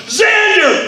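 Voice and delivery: retold angry yell; angry shout. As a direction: yell the name in fury. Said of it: "I was like".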